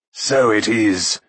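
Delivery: breathless, drawn-out exclamation; triumphant cry. A narrator's reading. Mag bearing the treasure to Akron, triumphant. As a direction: cry out in slow relish. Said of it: "he cried".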